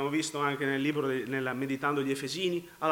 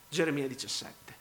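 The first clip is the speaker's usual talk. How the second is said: ordinary talk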